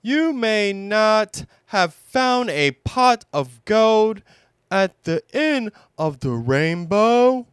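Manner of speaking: high, proud little bear; proud Pooh. Acted. Pooh showing Owl the berry pot, proud. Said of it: "said Pooh proudly".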